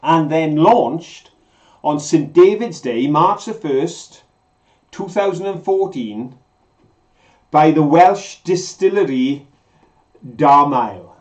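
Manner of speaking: slow, deliberate quiz delivery with pauses; measured quiz host, key words drawn out; quizmaster reading a question. Real, not acted. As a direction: spell out the question phrase by phrase.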